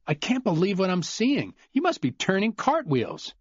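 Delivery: high, needling admiration; mock-impressed Cooper. Cooper needling Glenn about the game, high and mocking. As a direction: tease him by praising the game.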